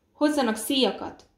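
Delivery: loud urgent demand; urgent command. A narrator's reading. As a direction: call out sharply for help. Urgent order to fetch straps.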